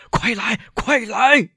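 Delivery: high, drawn-out desperate call; stretched, panicked high cry; urgent shout. A narrator's reading. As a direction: yell for help to come at once. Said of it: "他喊道".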